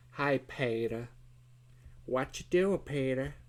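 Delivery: put-on nasal character voice; nasally voice. Artist calling out to Peter in a joke, nasally. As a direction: pinch it through the nose, playfully. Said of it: "That's my nasally voice".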